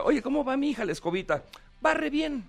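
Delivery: high little voices of the broom characters; squeaky high kids' voices; high cartoon voices. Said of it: "llega a la escuela". (vocecitas agudas de caricatura)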